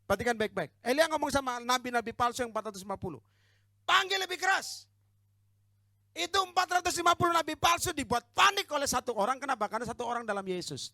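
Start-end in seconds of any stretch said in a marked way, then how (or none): 3.9-4.8 high, loud jeering call; shouted challenge in a preacher's acted quote; Elijah's taunting shout. Acts Elijah taunting false prophets. 6.1-10.9 loud, high, emphatic preaching; raised pulpit voice, insistent; full-force preaching. Real, not acted.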